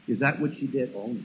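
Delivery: ordinary talk, nothing marked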